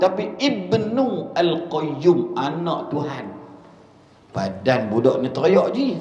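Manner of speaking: usual speaking voice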